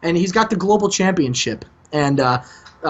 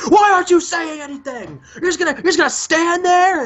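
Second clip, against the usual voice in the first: higher-pitched, half-yelled frustration; rattled yell; 9 semitones higher than usual. Retold or imitated. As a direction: demand an answer from the silent rival. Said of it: "and he's like".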